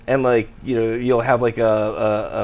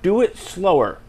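drawn-out director's note, raised in pitch; George Lucas; 5 semitones higher, slower than usual. Retold or imitated. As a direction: mimic a director with little to say. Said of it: "pretty good impression of George Lucas".

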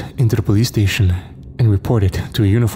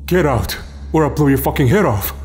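furious threat; 6 semitones higher than usual. Acted. Owner threatens with shotgun.

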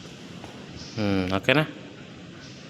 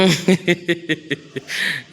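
light laugh; 5 semitones higher than usual. Real, not acted. Trainer laughs at client.

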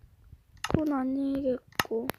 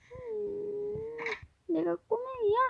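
ordinary talk, nothing marked

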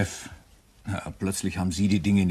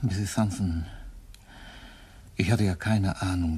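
ordinary delivery

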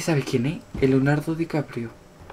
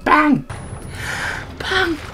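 calling the cat; 9 semitones higher, 6 dB quieter, much slower than usual. Real, not acted.

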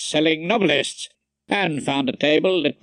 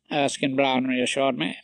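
plain reading voice

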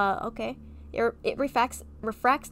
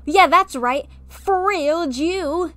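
slow, higher, loud hammed-up indignation; playfully outraged at a friend's clown prank; mock outrage; 8 semitones higher, 8 dB louder, much slower than usual. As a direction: drag out the fake fury theatrically.

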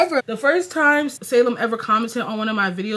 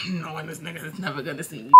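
laughing aside; 6 semitones lower, 13 dB quieter than usual. Real, not acted.

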